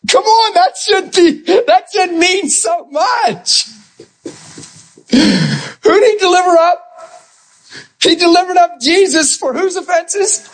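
high, impassioned preacher's cry, slow and stretched; preaching at full force. Acted. Preacher crying out to the congregation about Christ's sacrifice. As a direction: cry it out to the congregation like a revival peak.

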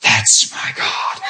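slow, whispered awe; hushed delight. Retold or imitated. Husband to wife as God's power comes over him, hushed delight. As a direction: whisper it low and slow, marveling. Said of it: "I was laughing, and I said".

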